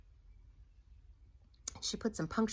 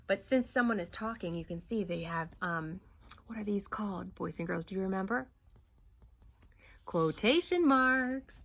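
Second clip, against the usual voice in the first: ordinary talk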